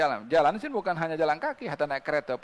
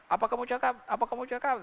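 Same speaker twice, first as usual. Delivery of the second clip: higher-pitched quoted question, lightly acted; asking to repeat; 7 semitones higher, faster than usual. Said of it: "Sampai Nabi tanya".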